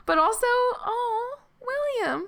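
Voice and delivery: very high, slow gushing into a whimper; high-pitched mock weepy lament; proud turning mock-tearful. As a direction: swell with pride, then crumble playfully. Proud, then mourns favourite troll.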